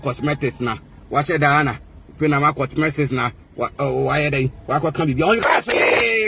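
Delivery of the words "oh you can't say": slow, higher mock-defiant shout; taunting a rival, raised and slow. Real, not acted. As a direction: drag it out as a shout at her.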